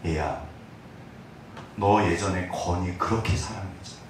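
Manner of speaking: soft, hushed voice of God; God's gentle voice. Retold or imitated. Pastor voices God reminding him he loved his son, hushed and gentle. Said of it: "무슨 생각이 떠올랐을까요".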